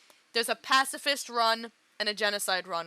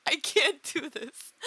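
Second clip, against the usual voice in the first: slow, teary and strained; real crying; 4 semitones higher, slower than usual. Real, not acted. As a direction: say it like you are about to break down. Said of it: "I'm, like, literally crying".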